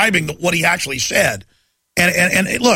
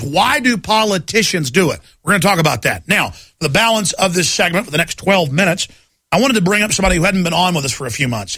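usual speaking voice

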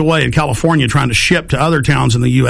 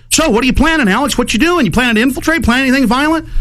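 high, sneering cop interrogation; mocking fed voice; 10 semitones higher, faster than usual. Mocking the fed who questions Alex, high sneering voice. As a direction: mimic the fed mockingly, pitched way up. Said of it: "and he was like".

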